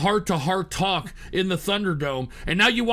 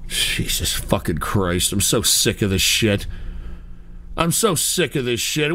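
low, defeated groan; 13 semitones lower, 5 dB quieter than usual. Real, not acted. Despair at prank calls.

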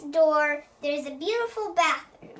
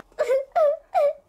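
high louder doll sob; mock crying wail; 10 semitones higher, 7 dB louder than usual. Barbie wailing over her hurt leg, high and loud. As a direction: cry out in play pain. Barbie cries after fall.

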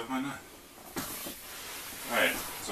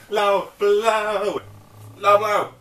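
high, loud laughing teasing; laughing taunt; 8 semitones higher, 5 dB louder, much slower than usual. Real, not acted. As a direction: chant it through laughter. Repeated teasing after gasping laugh.

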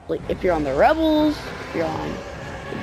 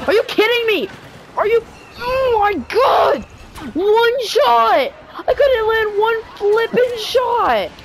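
furious high yell at the game; raging gamer; 11 semitones higher than usual. Real, not acted. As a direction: blow up in gamer rage. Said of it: "I'm rage quitting".

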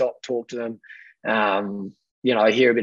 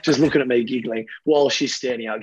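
ordinary talk, nothing marked

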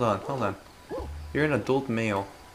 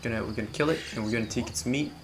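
usual speaking voice

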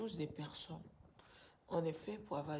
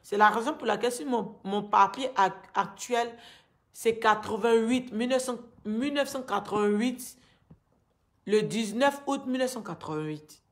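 raised, agitated pleading; upset; 2 semitones higher, 7 dB louder than usual. Real, not acted. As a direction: let the anger pour out louder. Angry account of forced marriage.

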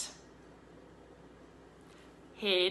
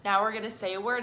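ordinary delivery

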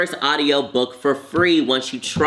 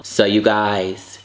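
drawn-out slow presentation; slow reveal; 3 semitones lower, much slower than usual. Real, not acted. Presenting the chicken and waffles to viewers, slow reveal. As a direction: savor each word as you unveil it.

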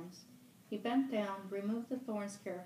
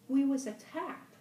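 urgent; 7 semitones higher, 9 dB louder than usual. Acted. Fat boy recalls the crash.

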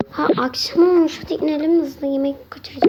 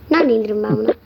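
ordinary talk

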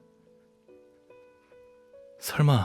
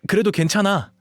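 higher, louder, upbeat burst; bright reassurance; 8 semitones higher, 5 dB louder than usual. Acted. Runaway reassuring the loved one after turning back, upbeat. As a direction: brush the setback off cheerfully.

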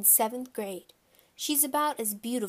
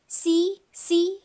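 put-on high, loud toddler shout; high-pitched, loud childish cry; excited small child; 8 semitones higher, 7 dB louder than usual. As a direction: call out like an eager little kid. Toddler shouts from jungle gym.

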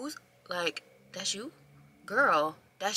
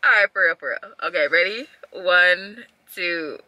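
speaking through covered mouth; hand-muffled giggly counting; muffled behind hand; much faster than usual. Real, not acted. Seen 2: Palm pressed over mouth throughout the counted words; none in refs.

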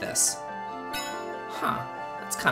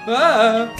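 loud, high cry of alarm; short gamer's yell under attack; startled yell; 8 semitones higher, 11 dB louder than usual. Real, not acted. Player under attack in the game, startled yell. Hit during game fight.

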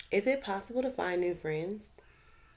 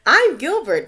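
much higher and louder, bright and eager; cheerful introduction; 11 semitones higher, 17 dB louder than usual. Acted. Gilbert introduces himself to the remora, eager and loud. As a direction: announce your name proudly.

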